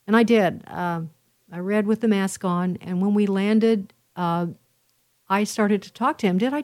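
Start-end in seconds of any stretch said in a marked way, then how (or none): none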